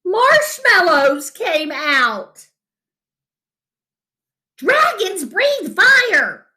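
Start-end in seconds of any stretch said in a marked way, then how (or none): 4.6-6.5 much higher, slow coach's yell. A narrator's reading. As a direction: bark the rule at the class. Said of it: "yelled the coach".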